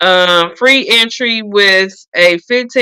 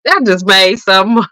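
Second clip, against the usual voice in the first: usual speaking voice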